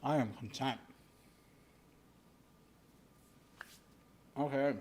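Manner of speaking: talking with a mouth full. Real, not acted. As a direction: speak around a mouthful of food. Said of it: "I'm sorry for talking with my mouth full".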